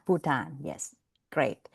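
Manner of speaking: ordinary talk, nothing marked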